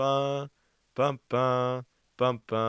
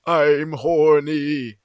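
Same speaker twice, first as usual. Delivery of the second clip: stretched out, higher and loud; slow loud lusty Gordon; 7 semitones higher, 13 dB louder, much slower than usual. Pretend. Gordon announces himself loudly to the engines.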